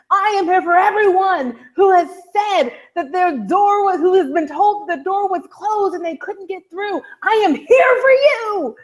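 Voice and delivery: fiery convention speech. Retold or imitated. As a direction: build it like a rally speech, stretching the last line. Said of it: "a fiery speech".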